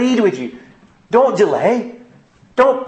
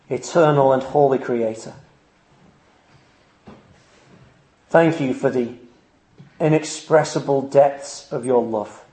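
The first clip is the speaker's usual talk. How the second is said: prayer; 5 semitones lower than usual. Real, not acted. Leads closing prayer.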